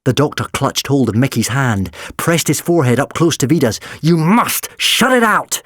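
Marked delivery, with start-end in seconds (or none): 4.0-5.6 desperate order. A narrator's reading. Doctor urges them desperately.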